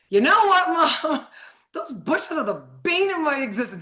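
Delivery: son's voice, pitched high, half-laughing at the bushes; imitating her son. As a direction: mimic a fed-up son grumbling at the bushes, up high. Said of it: "My son's like".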